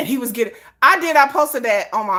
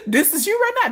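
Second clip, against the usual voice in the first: higher, brighter line for the traveler; higher voice for you; 10 semitones higher, faster than usual. Retold or imitated. Fans comparing her to the traveler, the you line said higher. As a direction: say the you part high. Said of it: "It's like".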